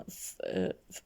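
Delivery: "ف-" as a bare f sound; letter sound demo. Shown as a demonstration. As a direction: sound out "ف-" as just "fff". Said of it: "نلفظهم".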